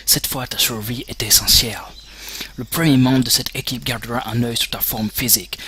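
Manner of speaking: normal talking voice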